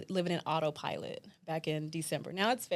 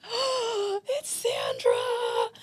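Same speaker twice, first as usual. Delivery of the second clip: gasping, drawn-out excited squeal; hammed-up delighted gasp; 13 semitones higher, 4 dB louder, much slower than usual. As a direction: imitate thrilled surprise at an old friend, stretched out slowly. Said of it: "I was so excited".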